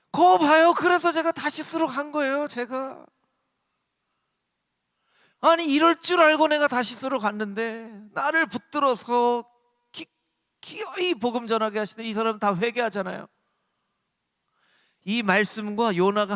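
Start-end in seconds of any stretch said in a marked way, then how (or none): 0.1-3.0 high, self-righteous acted protest; Jonah's indignant I-told-you-so. Preacher as Jonah claiming he did right, indignant self-justification. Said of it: "잘했다는 거예요". 5.4-13.3 higher, aggrieved acted protest; Jonah's angry complaint. Preacher as Jonah upset that Nineveh repented, angry complaint. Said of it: "막 성을 내면서".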